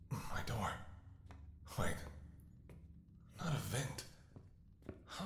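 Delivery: hushed, breathy whisper of alarm; a tense, barely voiced whisper. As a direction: whisper it so the guards don't hear. Sneaking out of the prison.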